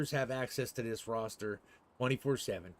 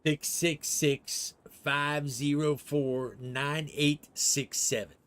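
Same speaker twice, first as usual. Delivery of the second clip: dictating; 3 dB louder, much slower than usual. Real, not acted. Reading number back slowly.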